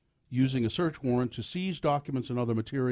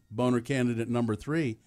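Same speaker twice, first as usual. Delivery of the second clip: usual speaking voice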